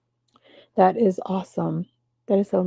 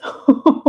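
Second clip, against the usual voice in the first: breathy laugh; 6 semitones higher, 7 dB louder than usual. Real, not acted. Laughing at her rosacea.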